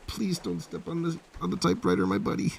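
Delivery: high soft plea to the cat; high soft coaxing to the cat on the typewriter; pet talk. Real, not acted. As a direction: gently ask the cat to get off.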